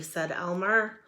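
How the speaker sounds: ordinary delivery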